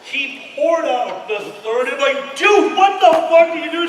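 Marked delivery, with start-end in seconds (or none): 2.4-3.9 high, loud incredulous yell; hammed-up disbelieving shout; mock yell. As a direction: shout it at your stoned buddy. Friend yelling at drugged pilot.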